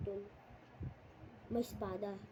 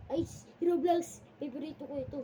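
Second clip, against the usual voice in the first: excited; 4 semitones higher, 5 dB louder than usual. Real, not acted. Excited discovery in game.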